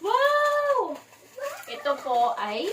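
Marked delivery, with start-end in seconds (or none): 0.0-1.0 put-on high, drawn-out wow for the baby; exaggerated wow. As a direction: sell the surprise to the toddler. Mock amazement for toddler.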